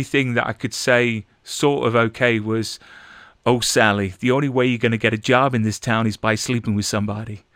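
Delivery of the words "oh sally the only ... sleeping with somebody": stage American accent from a musical. Shown as a demonstration. Actor recalling his Cabaret line, in an American accent. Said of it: "I had to do an American accent".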